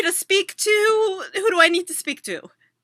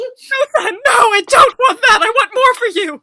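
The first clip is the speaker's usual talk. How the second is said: louder, tearful protest; mock sobbing mom; 5 semitones higher, 6 dB louder than usual. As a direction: plead through hammed-up tears. Mom cries back.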